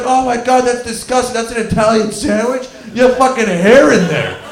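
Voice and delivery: high, loud imitation of appalled kids; hammed-up outcry, building to the end; mock disgust. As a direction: act out a classroom recoiling in disgust. Everyone mocks hair in his sandwich.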